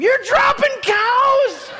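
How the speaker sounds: high, loud, slow yell; scream. Retold or imitated. Tony screaming at the truck driver about his cows. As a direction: scream it out the window in disbelief. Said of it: "I just screamed".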